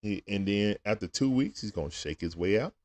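soft, low, gentle storytelling. Real, not acted. Father softly telling his son how the caterpillar becomes a butterfly, tender. Talking to baby about caterpillar.

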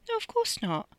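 high-pitched, quick denial; high protesting voice. Acted.

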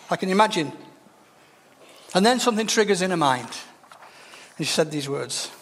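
ordinary talk

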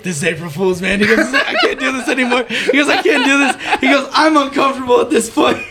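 high, loud, laughing confession; retold cracking deputy. Deputy giving up the April Fool's prank, high laughing retelling. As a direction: blurt it out, breaking up laughing.

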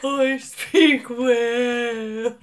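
very slow, drawn-out whale call. Pretend. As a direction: stretch every word like a whale singing. Whale voice continues.

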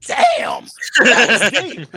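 stunned by the answer; impressed. Retold or imitated. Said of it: "And I was like".

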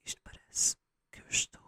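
breathy whispered test into the mic; soft whisper while testing the mic. Shown as a demonstration. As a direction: whisper close to the microphone. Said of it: "a cantar".

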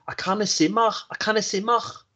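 high-pitched Scouse, "mach" ending in a throaty rasp; Scouse accent. Shown as a demonstration. Host demonstrates a practice phrase, Scouse accent. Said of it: "if you wanna do a good Scouser accent".

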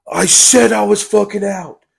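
high, drawn-out indignant declaration; retold angry outburst. Telling a friend how they quit the game, retold angry outburst. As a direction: raise the pitch and stretch out the fed-up protest.

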